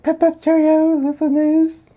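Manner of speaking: very high, loud cartoon English accent; mock English accent. Teasing the co-host about his newsreading, mock English accent. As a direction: squeal it out in a hammed-up English accent. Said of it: "I can't do an English accent".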